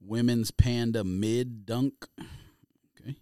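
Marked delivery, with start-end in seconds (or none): none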